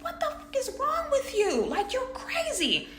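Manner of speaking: high, strained crying outburst at him; hysterical crying. Retold or imitated. To her boyfriend after he smashed the stovetop, crying hysterically. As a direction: yell it through tears, high and cracking. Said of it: "I'm just crying like".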